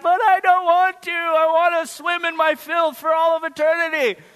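put-on whiny child. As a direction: drag it out like a sulking kid. Kid whining about bath time.

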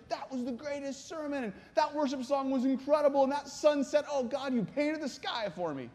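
high-pitched, breathless-convert enthusiasm; acted, wide-eyed rapture; gushing excitement. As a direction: gush as a new believer amazed by everything. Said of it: "They'd be so excited".

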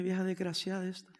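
put-on mild muttered curse at the old woman; muttered insult. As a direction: mutter it to yourself, offended. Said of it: "Y yo dije".

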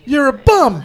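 imitating the lady's first jab; heckling lady. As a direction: jeer it like a street lady from Rocky. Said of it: "the lady goes".

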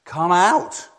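high, loud call rising at the end; commanding call. Acted. Preacher as Jesus calling into your grave, loud command. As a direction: call out into a tomb. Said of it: "Jesus speaks into your grave".

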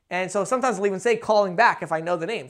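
usual speaking voice

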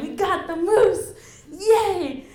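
father and son just bagged a moose, excited cheer. Real, not acted. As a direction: celebrate it like the best moment ever. Triumph after the kill.

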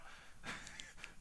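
soft, breathy chuckle; a quiet laugh to himself; real laugh. Real, not acted. Preacher amused by his own example, quiet laugh.

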